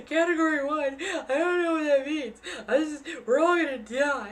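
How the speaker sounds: high, loud, half-laughing panic near tears; panicked laughing wail. Retold or imitated. West Coaster panicking over a Category one, laughing wail. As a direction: freak out, laughing and wailing at once.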